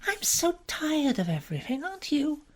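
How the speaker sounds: Frankie's voice. Acted. To Bobby, a weary young woman sighing about everything.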